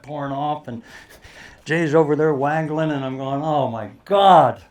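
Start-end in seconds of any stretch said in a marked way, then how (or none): 3.4-4.6 slow, loud nervous groan of dread; anxious oh my god. Retold or imitated. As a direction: drag it out, dreading the shot. Nervous caddie watching risky shot.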